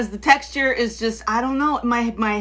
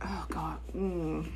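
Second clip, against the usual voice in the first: whisper; 6 semitones lower, 13 dB quieter, much slower than usual. Real, not acted.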